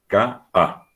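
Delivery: K A as ka, clean stop, then a; voice cut between vowels. Shown as a demonstration. Teacher correcting the run-together form, K A sharply separated. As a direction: break K A in two with a glottal catch. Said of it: "Separa, corta la voz".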